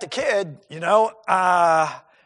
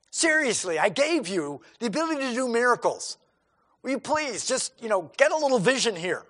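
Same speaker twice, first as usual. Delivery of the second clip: mild exasperated scolding, higher toward the end; a pretend fed-up teacher, half-hearted; exasperated Jesus; 4 semitones higher, 4 dB louder than usual. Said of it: "he could get exasperated".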